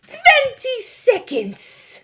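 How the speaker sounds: very high, loud witch cry. A narrator's reading. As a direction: shriek the countdown gleefully. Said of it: "cried the Grand High Witch".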